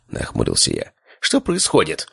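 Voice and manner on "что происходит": high-pitched shouted question; mild shout. A narrator's reading. Father approaching the quarrel, mildly shouting. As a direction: call out in alarm without much volume. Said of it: "закричал отец".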